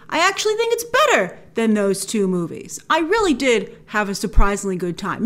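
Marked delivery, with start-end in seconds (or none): none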